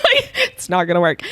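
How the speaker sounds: loud mock doubt through a laugh; laughing self-mockery. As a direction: laugh as you imitate your doubts. Laughing at own remembered doubt.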